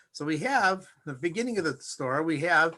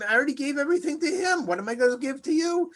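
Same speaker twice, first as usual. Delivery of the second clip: distraught father; 9 semitones higher, faster than usual. Acted. Father voiced, helpless.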